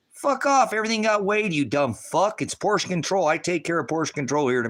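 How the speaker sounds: high, loud, furious comeback; angry retort. Retold or imitated. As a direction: bark it back at the whiner. Answers the complainer in his story.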